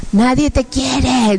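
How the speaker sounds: slow, drawn-out demonic taunt; horrible demon voice. Retold or imitated. Demons telling her she is unloved, horrible voice. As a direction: stretch it out like a tormenting demon. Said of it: "unas voces horribles que decían".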